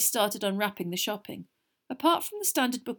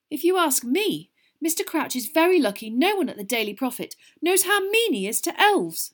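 higher, louder indignation; angry; 8 semitones higher, 6 dB louder than usual. A narrator's reading. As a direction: argue angrily on behalf of the mistreated. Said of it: "said Hermione angrily".

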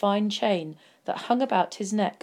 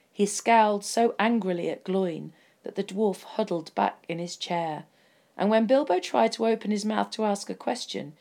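usual speaking voice

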